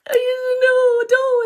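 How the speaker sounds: imitating a caught cheater's crocodile tears; 1 mock crying plea. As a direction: squeal out a fake tearful no, don't. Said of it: "and they're like".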